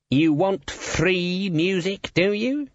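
high, very slow taunting question; menacing guild boss. A narrator's reading. Guild boss challenging his wavering musician, taunting. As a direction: draw it out and lift the end.

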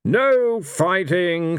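high, slow commanding shout; slow ruling shout to settle the room. Acted. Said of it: "shouted Blind Io".

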